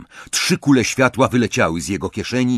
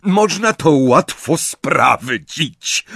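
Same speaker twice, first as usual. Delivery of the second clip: slow, raised, projected voice; Lucius; 5 semitones higher, 5 dB louder, much slower than usual. A narrator's reading. Lucius cuts into the interrogation, slow and commanding. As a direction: say it loudly, drawing the words out. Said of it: "rozległ się głos Lucjusza".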